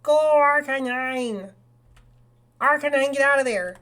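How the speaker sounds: high, loud battle call with drawn-out words; excited battle shout. Real, not acted. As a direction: shout commands to your Pokemon. Calling out orders in the game.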